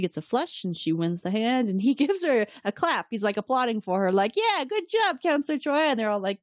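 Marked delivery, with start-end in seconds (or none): 4.4-5.9 high, overeager praise; odd cheer. Retold or imitated. Picard clapping for Troi after her flush, odd cheer. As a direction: cheer her on a little too much.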